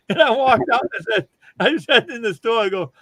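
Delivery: usual speaking voice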